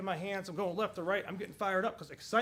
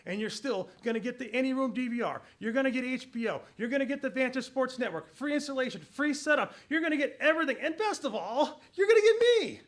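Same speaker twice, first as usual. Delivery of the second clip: climbing higher and louder into a laughing punchline; building excitement; 5 semitones higher, 7 dB louder than usual. Shown as a demonstration. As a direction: pile on the offers until it bursts into a laugh. Pitch climaxes, laughs at himself.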